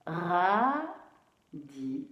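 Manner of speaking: "Radis" split in two, drawn-out "Ra"; syllable by syllable. Shown as a demonstration. Teacher re-reading the written word, syllable by syllable.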